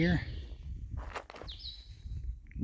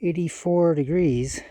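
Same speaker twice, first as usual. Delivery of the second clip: panting after a steep climb; breathless, words between gasps; out of breath; 5 semitones higher, 6 dB louder, slower than usual. Real, not acted. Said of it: "I'm out of breath right now".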